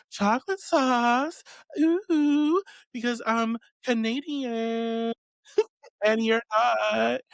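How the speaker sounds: high, slow, prissy impression of a friend; mocking a friend's voice about chocolate sauce and cinnamon. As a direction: mimic her smug Canadian boast, high and drawn out. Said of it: "I don't know why I have to say it like that".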